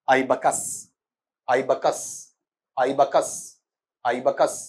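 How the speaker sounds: आइबकस repeated slowly as aibəkəs, stress on the first syllable आइ; pronunciation demo. Shown as a demonstration. Teacher modelling आइबकस pronunciation for students. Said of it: "इसका उच्चारण बेटा ऐसे आएगा".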